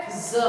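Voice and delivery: ज said as a buzzing z; nukta z sound. Shown as a demonstration. Teacher showing the dotted letter, ज pronounced as z. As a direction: voice ज as z, not j. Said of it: "नुक्ता लग जाता है तो उसका उच्चारण".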